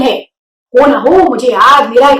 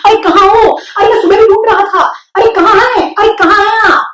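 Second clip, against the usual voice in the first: eager calling; 8 semitones higher than usual. A narrator's reading. Wilbur searching eagerly.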